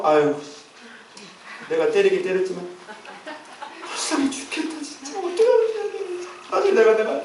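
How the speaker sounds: acted aching pity, breaking into a hushed fret; God taking pity. God hearing his punished people cry, acted fretful pity. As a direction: fret softly over someone you had to punish. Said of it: "사랑이 많으신지 부르짖는 소리 듣고요".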